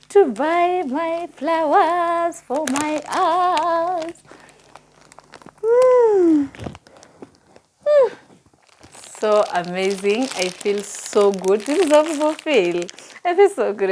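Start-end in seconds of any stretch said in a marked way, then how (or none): 0.1-4.2 much higher and slow, savouring the news; excited reveal. Real, not acted. Vlogger telling viewers she finally bought flowers, thrilled. 5.6-8.1 loud, high celebratory whoop; joyful woo. Real, not acted. Vlogger cheering over her new flowers, loud whoop. 11.6-13.9 delighted. Real, not acted. Celebrating the flowers.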